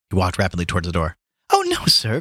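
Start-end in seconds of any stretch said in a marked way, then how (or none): 1.5-2.2 put-on high-pitched girl's protest, read aloud; protesting cry. A narrator's reading. Girl protesting to Gabriel as she follows him, high cry. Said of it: "cried the girl".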